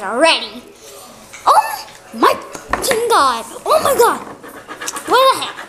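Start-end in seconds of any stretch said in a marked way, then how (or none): 1.5-5.6 high, startled outburst; shocked shout. Real, not acted. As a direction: blurt it out in disbelief. Shocked at a card pull.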